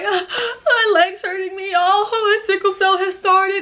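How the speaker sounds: high, crying, drawn-out whine; mock tearful wail. Telling a friend her legs hurt, tearful wailing. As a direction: wail it through put-on tears. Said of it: "Activate the tears".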